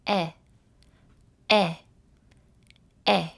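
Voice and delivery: short open "eh" with dropped chin, said three times; "Eh" with an open mouth smile; short E sound. Shown as a demonstration. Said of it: "Like this".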